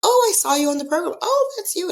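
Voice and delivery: high-pitched, gushing imitation of fans; excited fans. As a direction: act out strangers thrilled to spot you. Acted fans greeting her excitedly.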